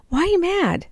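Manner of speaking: incredulous question. Retold or imitated. Confronting boyfriend by text.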